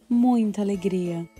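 ordinary delivery

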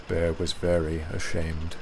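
ordinary delivery, nothing marked

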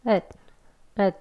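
"엇" ending with the tongue held on the ridge, no release; 혀끝을 붙이고 끝내는 "엇"; unreleased d. Shown as a demonstration. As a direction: say "엇" and stop with the tongue stuck. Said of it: "붙이고 끝날 거예요".

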